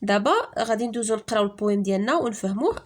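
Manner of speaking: usual speaking voice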